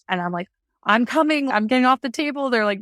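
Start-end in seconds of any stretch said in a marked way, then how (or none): 0.8-2.5 louder, higher determined protest; reenacted insistence. Mom reenacting wanting to follow her baby, loudly insistent.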